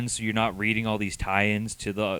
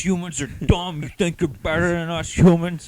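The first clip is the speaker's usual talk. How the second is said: higher, loud sneering villain voice; mock alien villain; 8 semitones higher, 5 dB louder than usual. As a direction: ham it up as a comic villain scorning humans. Mocking villain lines.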